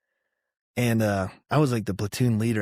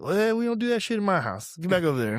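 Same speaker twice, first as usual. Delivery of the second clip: much higher comic sergeant ordering him back; drill sergeant impression; 9 semitones higher, faster than usual. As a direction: shoo the recruit off in a high mock voice. Sergeant continues scolding.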